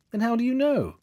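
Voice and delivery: asked in honest surprise; surprised. A narrator's reading.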